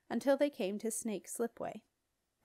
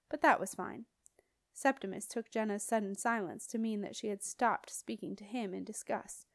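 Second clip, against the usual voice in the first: ordinary delivery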